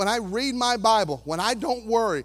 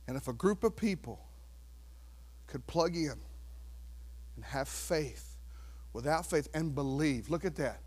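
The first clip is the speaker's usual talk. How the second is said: much softer and lower, intimate; hushed preaching; 7 semitones lower, 12 dB quieter than usual. Real, not acted. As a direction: pull them in close.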